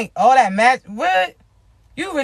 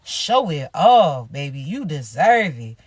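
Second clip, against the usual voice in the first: warm reassurance; 9 semitones lower than usual. Real, not acted. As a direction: gently hype them up. Reassuring viewers after being wronged.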